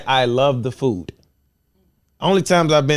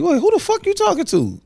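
caught off guard. Retold or imitated. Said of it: "it caught me off guard".

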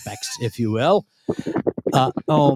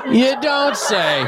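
laughing sarcastic; 6 semitones higher, much slower than usual. Pretend. Repeated with a laugh.

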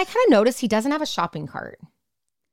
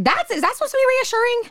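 incredulous retort; 13 semitones higher than usual. Retold or imitated. Incredulous retort to creepy text.

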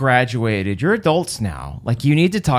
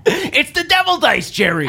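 very high sitcom exclamation; exasperated sitcom-character voice; Seinfeld impression; 13 semitones higher than usual. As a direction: cry it out high, hammed-up, then drop on the name. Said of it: "in a Jerry Seinfeld voice".

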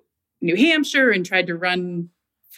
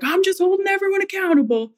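much higher, sing-song mock of him; mocking smug quote; 12 semitones higher than usual. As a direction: parody his self-righteous tone up high. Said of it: "he's like".

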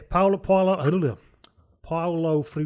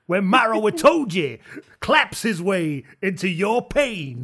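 slow, loud, higher hype voice booming out the pain to come; WWE wrestling announcer; 5 semitones higher, 5 dB louder, slower than usual. Pretend. Mock wrestling promo to rugby fans, slow and booming. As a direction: drag out each word like a ring announcer. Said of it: "talking like a W W E wrestler".

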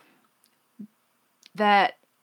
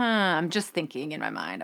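ordinary talk, nothing marked

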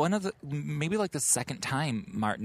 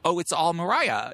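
excited exclaim; 7 semitones higher, 6 dB louder than usual. Pretend. Echoing mock shock.

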